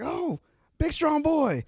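excited squeal. Retold or imitated. Fan greeting Tyler Bate's entrance, high and delighted. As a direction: coo it like a thrilled fan. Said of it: "And I was like".

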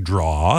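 "Draw." said low for drawer, one syllable; mock the guy who says "Draw." for both words; wrong pronunciation. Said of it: "just would always pronounce it wrong".

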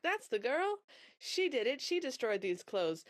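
high, whiny tattletale voices; accusing stepsisters. Retold or imitated. Stepsisters accusing Cindy to stepmother, high whiny tattling. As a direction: point the finger and squeal on her.

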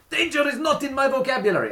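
high, loud mock Portuguese shout; thick Portuguese accent. Manager on the roof shouting down to staff, thick Portuguese accent. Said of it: "in his wonderfully thick Portuguese accent".

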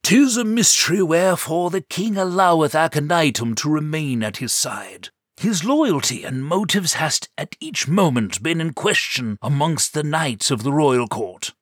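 put-on high, loud, old-fashioned knight; theatrical knight, laughing at first; hammy knight voice.

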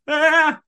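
sudden high, loud sung yell; mock theatrical wail; imitated singer's cry. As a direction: burst out like a hidden chorus. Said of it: "step out from behind the curtain".

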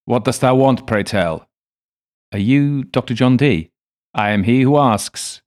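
lightly voiced archaic old man; a hint of an old scholar; older man's voice. A narrator's reading. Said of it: "an older man's voice ... speaking archaic English".

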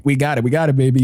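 ordinary delivery

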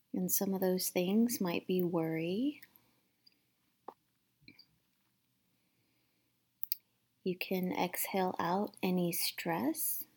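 gentle guided meditation voice, partly whispered; calm, hushed meditation guide. Acted.

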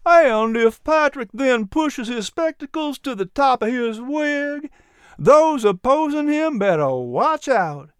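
chirpy little dog quoting Patrick; high, eager dog voice; little dog character voice. A narrator's reading. Miz P exclaims.

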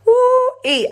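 high, held whoop; excited whoop. Real, not acted. Reacting to Anna's quote, high excited whoop. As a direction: let out a high woo-ee.

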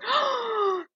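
a sharp high gasp before tears; panicked gasp. Retold or imitated. Alone in Paddington, realising she's broke: high panicked gasp. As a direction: suck in air as panic hits. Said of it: "and I just started crying".

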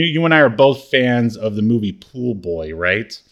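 ordinary talk

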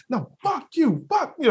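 high mock cursing; mock angry woman. Mimicking the woman cursing him, high mock anger. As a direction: ham up her spiteful shout.

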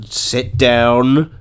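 usual speaking voice

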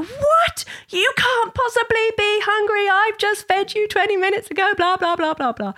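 very high, hammed-up outrage easing down at the end; mock angry. Cranky mummy to her giggling daughter, mock angry and high-pitched. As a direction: play the cranky mummy in squeaky indignation. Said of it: "became more and more mock angry".

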